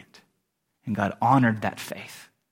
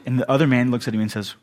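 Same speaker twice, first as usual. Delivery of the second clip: normal talking voice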